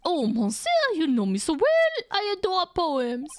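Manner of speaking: high, fawning French chef voice; French accent. Acted. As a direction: gush over it in French accent. French chef delighted by poem.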